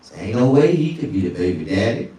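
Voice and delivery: ordinary delivery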